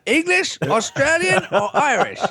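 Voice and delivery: put-on muddled British accent. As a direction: ham up an uncertain British accent. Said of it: "we could all talk like this".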